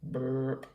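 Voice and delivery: low mock burp. Monster burping after its feast, low. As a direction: belch it out lazily.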